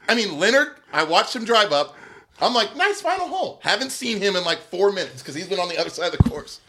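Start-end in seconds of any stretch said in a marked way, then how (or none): 2.7-3.6 high, light greeting; friendly call. Retold or imitated. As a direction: lightly imitate complimenting a golfer. Re-enacting friendly greeting on the course.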